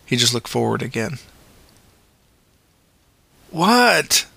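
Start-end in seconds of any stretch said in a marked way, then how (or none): 3.5-4.3 higher, sharper demand. A narrator's reading. Luke pressing the paramedic, demanding. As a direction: demand an answer. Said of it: "Luke demanded".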